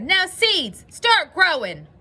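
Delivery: loud, high shouted command; shouting. A narrator's reading. Toad very close to the ground at his seeds, shouting. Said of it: "close to the ground and shouted".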